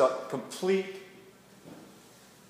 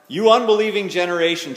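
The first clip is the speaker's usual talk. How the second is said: loud, raised-pitch exasperated rebuke; ticked off; 5 semitones higher, 10 dB louder than usual. Acted. Jesus to the unbelieving crowd, angry outburst. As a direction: act out an angry outburst at full voice. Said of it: "sounds really ticked off".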